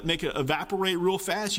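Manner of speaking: ordinary talk, nothing marked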